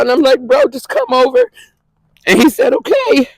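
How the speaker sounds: high, strained voice holding back tears; tearful. Real, not acted. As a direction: voice jumping high with real upset. Upset while retelling call to brother.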